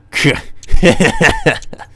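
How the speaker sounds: a loud, high, maniacal cackle; evil cackle. Acted. Frustrated killer hunting for his victim, loud maniacal cackle. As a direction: cackle like a murderer who has snapped.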